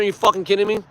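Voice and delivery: normal talking voice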